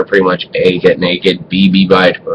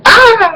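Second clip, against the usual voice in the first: very high, drawn-out scream; retold mom's scream. Mom walks in on dad in the bathroom, high startled scream. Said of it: "I hear my mom scream".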